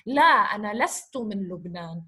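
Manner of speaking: normal talking voice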